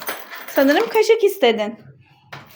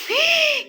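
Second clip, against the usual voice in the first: mock gasp; 12 semitones higher, 4 dB quieter than usual. Surprised at what's in the bag.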